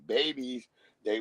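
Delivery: ordinary talk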